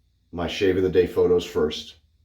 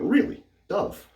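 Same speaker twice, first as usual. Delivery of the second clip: high-pitched incredulous question, then dropping off; retold disbelief; slower than usual. Husband recalling his doubt at the nurse's soap advice, mock disbelief. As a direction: act out a doubtful double-take.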